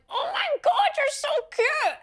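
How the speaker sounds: gushing excitement. Retold or imitated. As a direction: coo at them like they're adorable. Gushing over little girls at school.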